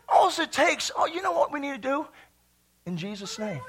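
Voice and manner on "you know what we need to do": ordinary delivery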